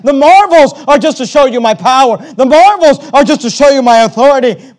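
loud, high, driving sermon delivery; fiery pulpit voice; full-force preaching. Acted. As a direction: preach it hard to the congregation.

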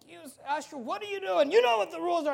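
sharp high rebuke at the usher; angry pastor yelling. Retold or imitated. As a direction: call him out in front of everyone. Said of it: "He's just like".

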